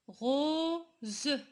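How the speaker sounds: "Rose" drawn out as RO, pause, ZE; split into sounds. Shown as a demonstration. Teacher repeating the example for pupils, "Rose" split into sounds. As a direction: stretch "Rose" in two parts with a buzzing z. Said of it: "Pourquoi on a dit Z".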